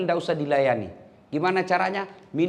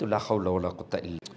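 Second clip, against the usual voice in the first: weary low sigh-prayer; 10 semitones lower, 7 dB quieter, faster than usual. Acted. Exasperated aside about nagging wife.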